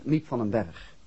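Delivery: ordinary talk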